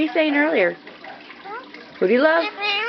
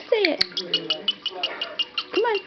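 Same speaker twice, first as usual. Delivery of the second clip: ordinary talk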